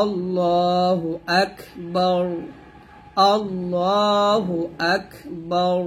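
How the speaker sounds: slow আল্লাহু আকবার with a thick র on the final stop; slow recitation with a heavy final র. Shown as a demonstration. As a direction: chant আল্লাহু আকবার slowly, ending on a heavy র. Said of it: "এরকম ভাবে".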